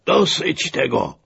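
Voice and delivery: high, slow cry of protest; shouted command. A narrator's reading. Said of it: "krzyknął major".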